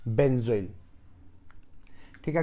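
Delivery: usual speaking voice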